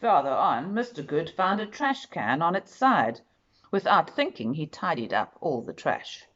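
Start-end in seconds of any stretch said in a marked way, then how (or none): none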